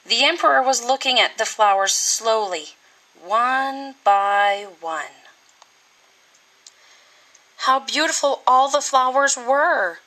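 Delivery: ordinary delivery, nothing marked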